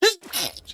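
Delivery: one sharp sneeze; sneezing. Real, not acted. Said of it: "I'm gonna sneeze again".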